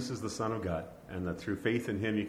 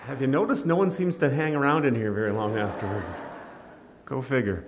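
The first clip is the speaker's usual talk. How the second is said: loud, higher, playful call across the room; loud joking call; 5 semitones higher, 9 dB louder than usual. Real, not acted. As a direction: project the joke loudly to the crowd. Pastor jokes loudly after baptism.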